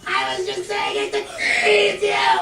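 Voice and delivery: agonized screams of panic; screaming. Acted. Man caught in the fire pleading, screaming. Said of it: "Stop the screaming!".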